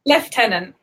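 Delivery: lieutenant as "lef-tenant", with an F; British pronunciation. Shown as a demonstration. Repeating it on request for the American friend, British F pronunciation. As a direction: pronounce lieutenant "lef-TEN-ant". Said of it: "Say that again, one more time".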